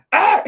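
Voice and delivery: short, sudden imitated yell; abrupt retold holler; mock yell. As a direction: let out a quick cartoonish "Ah!". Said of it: "literally yells at them".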